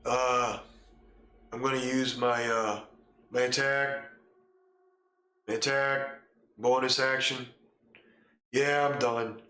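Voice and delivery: lazy, listless mumble; half-hearted bored player voice; bored and flat. Retold or imitated. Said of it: "Most people are like".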